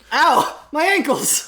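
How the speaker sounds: high hammed-up yelp; mock pain. Fan character yelps as her ankles buckle, hammed-up. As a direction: cry out as the ankles give.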